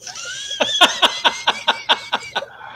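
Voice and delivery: high, loud shrieking burst of laughter; wild laugh rising to a shout. Real, not acted. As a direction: crack up at your own joke. Laughing at own AI joke.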